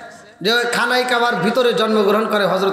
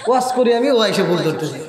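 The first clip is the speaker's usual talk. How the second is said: usual speaking voice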